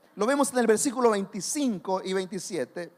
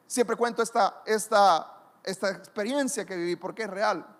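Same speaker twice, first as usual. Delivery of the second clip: ordinary talk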